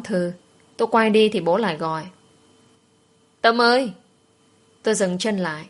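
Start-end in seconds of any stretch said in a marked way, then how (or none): 3.4-3.9 a father calling across the school gate. Acted. Father calling his daughter back as she walks off, raised call. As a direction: call her name out, higher and louder.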